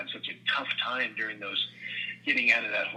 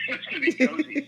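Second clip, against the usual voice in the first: high, laughing, louder announcement; laughing reveal; 5 dB louder than usual. Retold or imitated. Dad telling his wife the product name, laughing. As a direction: burst out laughing as you reveal it.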